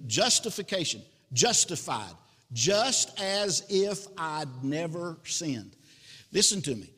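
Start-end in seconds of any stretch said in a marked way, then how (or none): 2.5-5.8 "just as if I'd never sinned" slow, word by word; spelled-out meaning. Shown as a demonstration. Preacher explaining justified to listeners, "just as if I'd never sinned" slow and deliberate.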